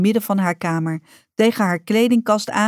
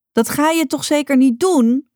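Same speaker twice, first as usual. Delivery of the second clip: sharp indignant question, pitched much higher; fierce; 8 semitones higher, 4 dB louder than usual. A narrator's reading. Rocca to her mother about the building, fierce and indignant. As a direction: fire it at her in disbelief. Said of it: "hoe fel ze klonk".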